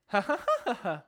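imitated stepped laugh, falling note by note; arpeggio laugh. Imitating sister laughing at a joke, arpeggio laugh. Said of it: "like, in a perfect arpeggio".

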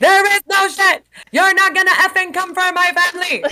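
high, loud acted fury; worked-up mock tirade; indignant outburst. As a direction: shout it in defence of your own. Acts out Angie's angry outburst.

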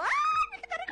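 high shrieking fan cry; fan scream. Retold or imitated. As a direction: squeal like seeing your idol. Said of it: "Cuando te dice como". (grito de fan, muy agudo)

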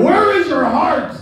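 high, slow mock yell at a kid; retold yell. Great uncle Billy yelling at the bleeding kid, high mock yell. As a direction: bark the question like a tough old uncle. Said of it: "he starts yelling at me".

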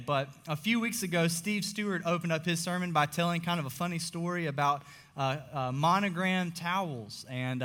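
ordinary talk

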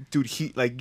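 usual speaking voice

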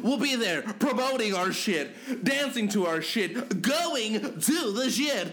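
high, loud, hyped host voice; show outro. Acted. Hosts signing off the show to listeners, loud and hyped. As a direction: hype the outro and promo with energy.

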